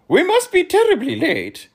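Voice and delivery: high, loud flustered Earl voice; anxious hurried Earl; nervous gasp. Acted. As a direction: fret about being late. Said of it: "gasped the Earl nervously".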